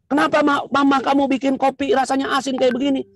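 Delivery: high, peeved husband voice; husband griping at his wife about the coffee; complaining husband. Acted.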